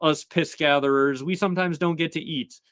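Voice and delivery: high-pitched put-on character voice; Tangletongue. Underling explains to the annoyed king about food, high put-on voice. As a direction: lightly imitate a hapless underling, pitched well up. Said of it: "he is told by Tangletongue".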